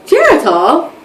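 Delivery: imitated friend, voice lifting at the end; friend's questioning echo. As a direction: repeat the name as a surprised question. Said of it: "She's like".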